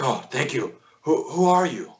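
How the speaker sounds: lightly voiced gnome, rising on the question; a hint of a small, startled creature; grateful gnome. Rescued gnome asks strangers who they are, lightly put-on voice. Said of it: "the gnome is like".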